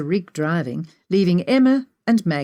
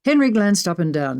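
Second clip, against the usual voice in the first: ordinary delivery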